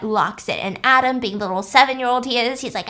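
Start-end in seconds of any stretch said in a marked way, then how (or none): none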